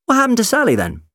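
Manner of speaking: high, loud, cheery question; breezy and upbeat; bright. A narrator's reading. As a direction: ask it brightly, pitched right up. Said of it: "the doctor said brightly".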